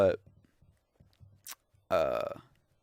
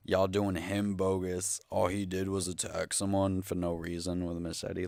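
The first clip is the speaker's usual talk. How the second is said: ordinary delivery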